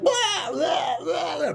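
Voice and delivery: high shouted gibberish; mock dying wail; dying screams. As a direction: scream nonsense while torn apart. Victim torn apart.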